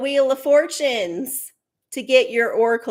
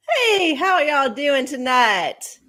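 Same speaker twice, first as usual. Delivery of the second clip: normal talking voice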